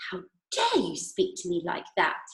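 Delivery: ordinary delivery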